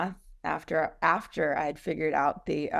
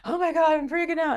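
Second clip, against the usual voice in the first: high, fast mock freak-out; mock panic; 11 semitones higher, 4 dB louder, faster than usual. Partner imitated freaking out over the business, high and fast. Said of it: "He's like".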